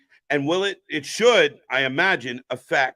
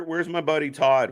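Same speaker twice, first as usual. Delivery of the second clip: ordinary talk, nothing marked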